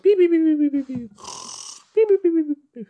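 very high rapid beeps; tiny high-pitched machine beeping; imitated beeping. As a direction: squeak out a string of electronic beeps.

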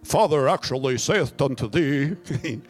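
slow, lightly archaic snake voice; a hint of a pompous old-English serpent; mock King James voice; King James Satan. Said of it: "Satan spoke King James".

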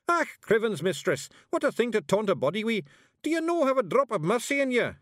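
high, loud outcry from a little Scots warrior; outraged Scottish shout. A narrator's reading. Tiny warrior protests witch's cruel taunt, outraged shout. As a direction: protest at full pitch in Scots. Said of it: "he shouted".

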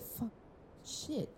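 normal talking voice

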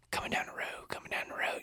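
hushed hunting whisper, no voice at all. Retold or imitated. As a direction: whisper it so the bird can't hear. Casey warning in the blind.

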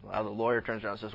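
ordinary delivery

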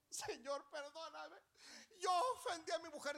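a touch of sobbing repentance; tearful prayer. Acted. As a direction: beg forgiveness with a catch in the voice.

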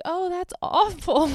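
high, loud put-on concern; exaggerated fake dismay; mock sympathy. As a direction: overdo the pity in a high voice. She feigns sympathy.

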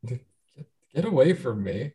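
usual speaking voice

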